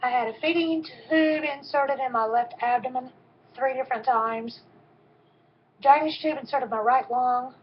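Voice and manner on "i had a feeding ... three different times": plain reading voice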